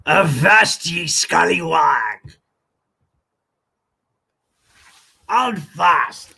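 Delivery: lightly voiced growly-free hammed pirate. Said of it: "that's not how pirates actually talk".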